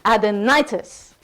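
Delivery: "Adenitis" slowly with a big NI; stress on NI. Shown as a demonstration. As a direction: stretch and punch the NI in "Adenitis". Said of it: "We're gonna jazz hands the ni".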